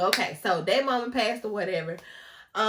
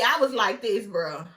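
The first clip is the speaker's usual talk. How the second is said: ordinary talk, nothing marked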